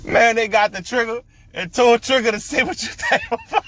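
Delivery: barely holding in the laugh; laughing while talking. Real, not acted. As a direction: squeeze the words out through laughter. Cracking up telling story.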